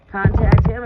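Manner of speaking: sudden loud burst on contact him; excited shout. Real, not acted. Advising a friend on revenge, sudden loud burst. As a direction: blurt it out loud.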